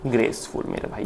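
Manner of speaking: breathy whispered word for the class. Shown as a demonstration. Teacher whispers the new word graceful to his students. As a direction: let it fall to a whisper.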